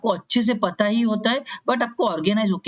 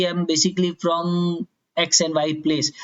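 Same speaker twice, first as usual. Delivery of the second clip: sample answer; much slower than usual. Shown as a demonstration. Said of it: "तो आप बोलो कि".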